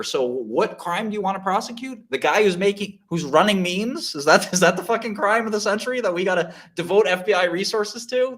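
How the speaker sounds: high, incredulous outrage; high, incredulous outrage at the FBI; outraged. Real, not acted. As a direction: scoff at the prosecution. Outraged rhetorical questions.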